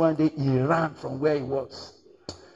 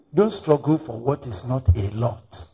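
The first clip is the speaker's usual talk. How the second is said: ordinary delivery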